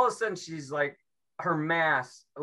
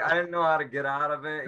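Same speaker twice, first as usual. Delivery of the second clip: usual speaking voice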